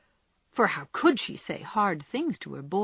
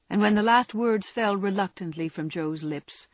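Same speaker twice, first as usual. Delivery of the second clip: ordinary delivery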